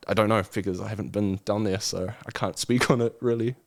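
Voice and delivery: ordinary delivery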